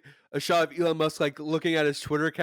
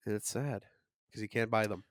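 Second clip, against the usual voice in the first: much softer, low, mock-sad aside; mock sympathy for Musk, said much softer; mock pity; 5 semitones lower, 9 dB quieter than usual. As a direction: drop to a mock-sorrowful hush.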